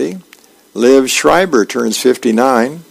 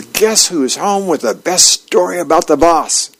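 cheery arrival; 5 semitones higher than usual. Retold or imitated. Dagwood bursts in cheerfully.

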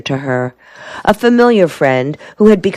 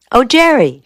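higher-pitched delighted greeting; glad exclamation; 8 semitones higher than usual. A narrator's reading. Helen greets her visiting soldier, glad exclamation. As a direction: exclaim with pleasure at seeing a sweetheart. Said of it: "she exclaimed".

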